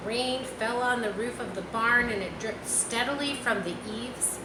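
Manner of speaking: ordinary delivery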